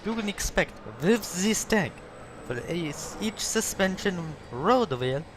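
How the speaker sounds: high, slow mock Russian accent, stretching each word; hammed-up Russian accent. Gamer hams up a Russian accent while showing tank suspension. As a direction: play a goofy Russian tank salesman in a Russian accent. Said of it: "Okay, I'm gonna do it".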